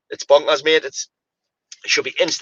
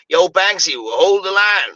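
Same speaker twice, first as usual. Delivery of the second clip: slow, drawn-out mock Australian call; lightly put-on Australian accent, stretched out; a hint of an Australian referee; 3 semitones higher, slower than usual. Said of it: "He sounds Australian".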